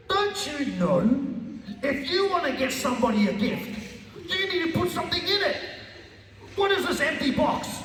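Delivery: high, ranting angry dad; furious father. Retold or imitated. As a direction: rage at the empty box. Father rages over empty box.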